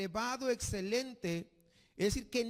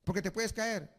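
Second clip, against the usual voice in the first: tender mother; 4 semitones lower, slower than usual. Retold or imitated. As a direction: gently hold the child back. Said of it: "Y la mamá le dice". (voz suave y lenta de madre que protege)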